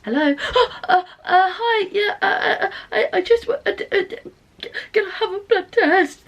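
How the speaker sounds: high, panicky, stuttering phone call; anxious stammering. Shown as a demonstration. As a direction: stammer nervously, gasping for words. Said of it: "I hate talking on the phone".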